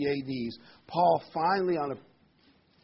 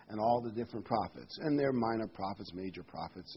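ordinary talk, nothing marked

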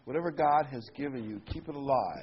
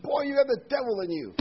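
high, louder scolding mother; mother scolding her son, mock angry; imitating angry mother; 10 semitones higher, 5 dB louder than usual. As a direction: scold the boy like a mad mother. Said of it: "Mother got mad at me and said".

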